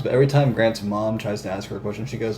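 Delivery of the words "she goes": ordinary talk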